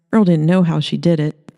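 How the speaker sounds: ordinary delivery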